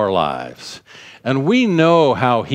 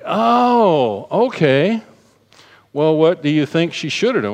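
mock surprise; 5 semitones higher than usual. Retold reply in mock surprise.

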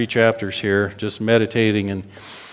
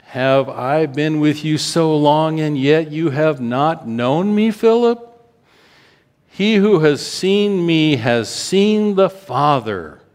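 slow, higher, aching "Philip?"; pleading rebuke; 6 semitones higher, 4 dB louder, slower than usual. Acted. Jesus asking Philip how he could not know him, aching. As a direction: let the hurt rise on the name.

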